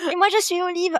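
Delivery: high, playful mock French lady; French accent. Joking in French with her co-host about Popeye. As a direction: chirp it like a Parisian Olive. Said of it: "Sorry, French accent".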